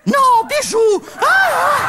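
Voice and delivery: high-pitched outburst; frantic acted cry. Scolding the dog mid-embrace with Rudy, frantic cry.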